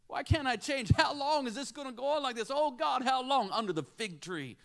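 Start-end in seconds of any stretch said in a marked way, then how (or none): 0.1-3.5 higher-pitched, urgent retold plea; crying out to God. Augustine praying under the fig tree, crying out to God. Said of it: "Augustine crying out to God".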